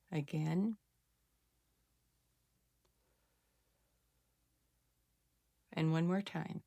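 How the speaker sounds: soft, hushed breathing instructions with long pauses; guided breathing cue. Shown as a demonstration. Healer guiding viewers through a calming breath exercise, hushed.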